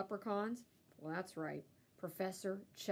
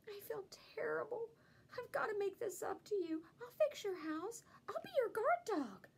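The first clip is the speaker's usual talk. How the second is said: remorseful dog; 12 semitones higher than usual. A narrator's reading. Dog apologizing.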